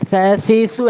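"ঝা, ঝি, ঝু" as a light th, as in "think"; tongue tip lightly at front teeth on "ঝা, ঝি, ঝু"; light, normal articulation. Shown as a demonstration. Teacher modelling Tha, very light th sound. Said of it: "একদম হালকা করে normally".

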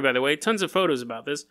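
usual speaking voice

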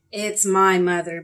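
loud, slow declaration; loud announcement. A narrator's reading. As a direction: announce it slowly to the whole room. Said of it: "Barry announced loudly".

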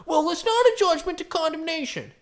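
loud, high-pitched mock protest; hammed-up indignant objector; mock objection. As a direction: argue it in an exaggerated high voice. Mimicking an argument with objectors.

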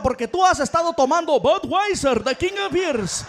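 loud hyped radio ad voice; Spanish radio ad. Acted. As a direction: pitch the night like a radio ad. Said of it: "what's he gonna tell her".

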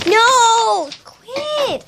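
sharp high cry of protest; startled yell. Real, not acted. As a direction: yell at your friend to stop. Yelling at friend mid-story.

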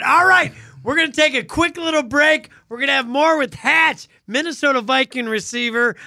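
upbeat host announcing break; radio host. Acted.

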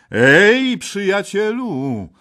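King Arthur shouting at the green knight; calling out. A narrator's reading. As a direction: leap up and shout at the stranger. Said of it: "zawołał".

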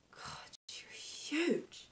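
slow, drawn-out whispered aside; hushed amazement. Acted. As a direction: breathe it out slowly in disbelief. Awed at friend's pregnant belly.

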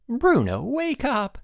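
put-on very high-pitched boy calling someone awake; urgent wake-up call. A narrator's reading. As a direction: pipe up high to rouse a sleeper. Messenger waking a sleeping boy.

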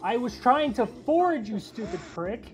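annoyed outburst. Real, not acted. Teammate ruined foraging.

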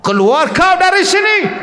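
mock furious high yell; shrill mock exorcist shout; angry shout. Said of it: "Setengah orang marah".

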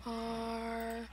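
slow, soft dramatic recitation; hammed-up narrator; mock poetic. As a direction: read it like a solemn poem.

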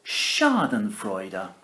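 "Schadenfreude" slow, SHAR-den-froy-da; saying Schadenfreude. Shown as a demonstration. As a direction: spell out "Schadenfreude" slowly. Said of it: "Why don't you try saying it".

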